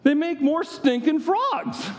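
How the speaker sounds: high, slow and scornful; mock disbelief. Preacher ridiculing the magicians to the congregation, high and scornful. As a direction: drag out the absurd idea.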